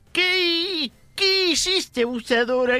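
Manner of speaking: high, put-on old man scolding; old grandpa voice. Grandpa using the word in a sentence, high old-man voice. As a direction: squeak it out like a cranky grandpa. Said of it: "dice el abuelito de Piolín".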